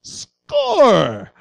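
высокий ликующий выкрик; mock cheer. Touchdown celebration in sermon.

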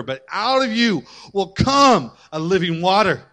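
loud, driving sermon delivery, building to peaks; full-force preaching. Acted. Pastor preaching redemption to ladies, full-force. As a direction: preach it out to the whole church.

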